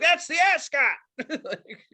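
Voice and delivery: high, loud mock-posh greeting; pompous ascot mascot.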